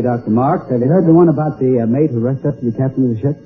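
ordinary talk, nothing marked

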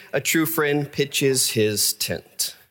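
slow, carefully separated words; very slowly. Real, not acted. Preacher repeating his proverb to avoid an awful mistake, very slowly. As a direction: take your time over every word. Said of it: "say it very slowly".

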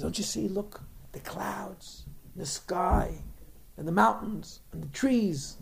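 soft, coaxing giraffe pointing out sights; wondering pointing-out. Acted. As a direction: point out each sight softly, item by item. Giraffe showing goat the view.

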